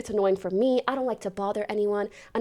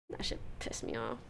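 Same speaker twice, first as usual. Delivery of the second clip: whisper; 13 semitones lower, 13 dB quieter than usual. Real, not acted. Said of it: "whispered aside".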